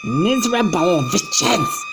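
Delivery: high, loud scornful shriek; angry witch yell. Acted. Grand High Witch berating her lazy witches, furious screech. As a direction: spit it at them with contempt. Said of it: "she yelled".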